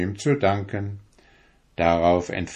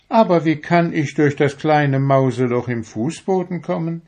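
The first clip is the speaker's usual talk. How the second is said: put-on wondering boy; 7 semitones higher, 3 dB louder than usual. A narrator's reading. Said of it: "fragte Friedrich".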